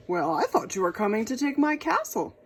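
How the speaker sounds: king voice. Acted. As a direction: speak as the worried king.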